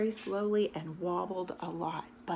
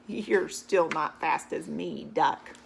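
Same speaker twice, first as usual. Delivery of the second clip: lower, slow boastful horse; smug horse voice; 4 semitones lower, 5 dB louder, slower than usual. Acted. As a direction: gloat slowly, deep like a horse.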